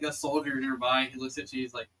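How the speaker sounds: normal talking voice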